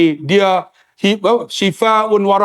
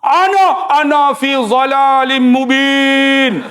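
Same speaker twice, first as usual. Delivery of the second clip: slow Quranic recitation; 4 semitones higher, much slower than usual. Retold or imitated. Uncle reciting Quran verse.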